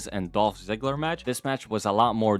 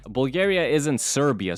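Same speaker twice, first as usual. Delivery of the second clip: normal talking voice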